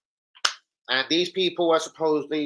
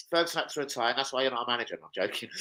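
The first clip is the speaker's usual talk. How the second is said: normal talking voice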